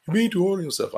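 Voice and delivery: a second voice telling him off; scolding other voice. Acted. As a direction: answer yourself as someone else pushing back. Answers himself in another voice.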